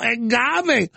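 high, drawn-out wail on agave; whiny mock cry. Mocking AOC, then own retort.

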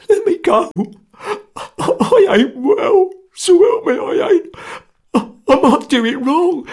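very high, stammering, tearful terror; whining, sobbing plea. A narrator's reading. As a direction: whine and beg, breaking into sobs. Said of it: "whined and sobbed desolately".

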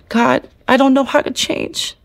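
high, breaking voice pleading with God; tearful pleading prayer. Retold or imitated. Alone in a jail cell, begging God to change her, tearful and high. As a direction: plead through tears, voice climbing. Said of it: "and I was crying".

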